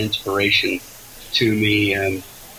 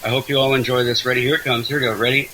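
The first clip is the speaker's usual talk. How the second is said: ordinary talk, nothing marked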